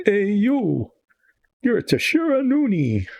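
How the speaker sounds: high shouted taunt in a quasi-fake Eastern European Russian accent; bard's fake Russian accent. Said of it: "quasi-fake Eastern European Russian accent".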